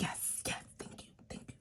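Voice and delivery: barely audible whispered thanks; grateful whisper. Retold or imitated. Mother backing big sister's money talk, grateful whisper. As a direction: whisper it under your breath, repeating quickly.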